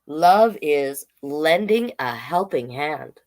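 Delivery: slow, sing-song picture-book read; reading to children. Acted. Drag queen reading a picture book to kids, slow and sing-song. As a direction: read each page slowly to the little ones.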